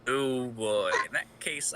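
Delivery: ordinary delivery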